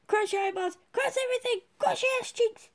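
escalating squeaky pleading; rising excited plea. Real, not acted. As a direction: pitch up steadily as the hope builds.

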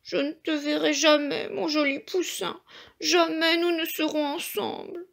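a touch of despair for the lost chick; understated mournful mother hen; lamenting hen. A narrator's reading. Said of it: "Elle se lamentait".

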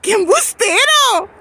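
drawn-out high teasing; teasing scold. Real, not acted. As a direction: stretch it out teasingly. Mock scolding a fibbing child.

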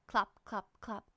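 plain reading voice

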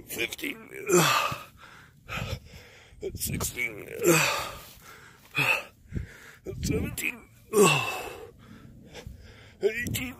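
counting through the strain. Real, not acted. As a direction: gasp out each count.

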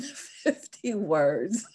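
words breaking into a laugh. Real, not acted. Host amused by the tight word limit, laughing. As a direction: laugh through the last words. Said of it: "I'm laughing because".

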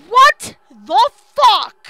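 drawn-out, loud, high shocked cry; shocked shout. Real, not acted. Horror gamer shouting in slow shock at the screen. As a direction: let the shock stretch the words out.

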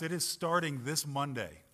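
usual speaking voice

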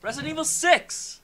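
high, loud insistent yell; exasperated shout. Real, not acted. Correcting a friend who keeps answering wrong, exasperated shout. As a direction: shout it to finally get through. Repeating, friend not listening.

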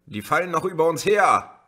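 called out high and loud; urgent call. A narrator's reading. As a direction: hurry everyone into the car. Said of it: "rief die Rotblonde". (drängend gerufen)

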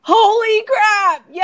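stretched, high-pitched excited shout; amazed cheer. Real, not acted. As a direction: burst out in real astonishment. Friend spells very hard word right.

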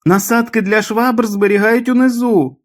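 high, drawn-out delirious yell; mother's high-pitched scream from another room. A narrator's reading. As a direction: cry it out slowly at a high pitch. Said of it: "закричала мати".

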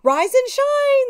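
high, loud wake-up call. A narrator's reading. Witches calling to a witch on the log. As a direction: ring it out high. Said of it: "they called".

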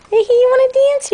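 high, loud playful question to the dog; excited dog-talk. Real, not acted.

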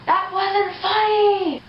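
drawn out and high; slow high voice. Real, not acted. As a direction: stretch it out up high. Old video deadpan.

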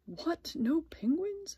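high, incredulous storybook question; shocked question. A narrator's reading. Splat learns there are no penguins, incredulous question. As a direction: ask it in disbelief, pitch jumping up.

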